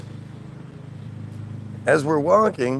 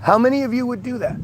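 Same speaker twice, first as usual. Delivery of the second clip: incredulous; 9 semitones higher, faster than usual. Real, not acted. Ranting at the audience.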